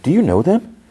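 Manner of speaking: pry with eager curiosity. Retold or imitated. Nosy Belinda asks eagerly.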